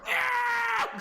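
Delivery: very high, loud shouted ah; imitated yelp. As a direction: shriek of dismay. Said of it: "You see Devin go".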